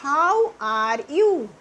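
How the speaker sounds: How are you stretched very slowly. Shown as a demonstration. Teacher models How are you very slowly for pupils. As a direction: drag every sound of How are you out. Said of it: "आप English में क्या बोलोगे?".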